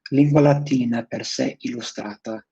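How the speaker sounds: Latin title read with an Italian accent. Real, not acted. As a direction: say it the Italian way, rolled r and open vowels. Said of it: "saying that with a very Italian accent".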